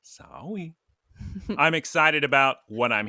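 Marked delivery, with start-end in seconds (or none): 0.0-0.7 put-on soft babyish "Sorry" with the r softened to a w; cutesy baby talk. Host echoing co-host's spoiler apology, soft baby talk. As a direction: echo a toddler's apology, very softly.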